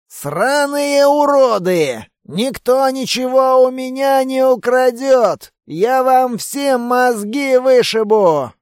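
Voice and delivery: put-on slow, high drunken roaring; belligerent drunk. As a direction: bellow it like a raging drunk. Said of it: "заорал бес пьяным голосом". (пьяный яростный рёв)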